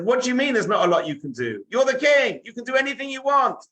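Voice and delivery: high, impassioned pleading; boy's loud, desperate appeal to the king; insistent protest. Acted. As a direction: refuse to give up, voice climbing high. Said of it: "But Brett didn't give up".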